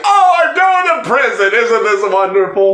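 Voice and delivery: high, shouted elation; manic joy. Retold or imitated. George facing arrest, high giddy shout. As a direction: gleefully shout about prison. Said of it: "He's like".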